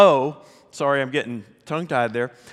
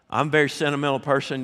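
ordinary talk

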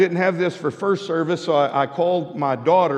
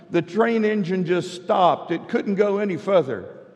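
lightly raised storybook voice; reading to children; 5 semitones higher than usual. Acted. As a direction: tell the train story to the kids. Retelling a children's book.

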